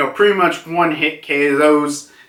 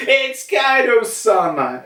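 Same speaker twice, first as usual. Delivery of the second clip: high, proud cry; proud Ute; 9 semitones higher than usual. Retold or imitated. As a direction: proclaim your master's name. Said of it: "Ulte's like".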